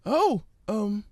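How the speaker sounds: Fluttershy. Acted. Fluttershy answering the hummingbird, high and gentle.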